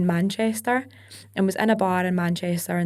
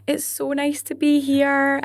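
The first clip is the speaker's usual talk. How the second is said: slow, higher, carefully enunciated stage greeting; slow enunciation; 7 semitones higher, slower than usual. Shown as a demonstration. Singer greeting a crowd not from Scotland, slow enunciation. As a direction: spell it out slowly for foreign listeners. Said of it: "have to speak really, really slowly".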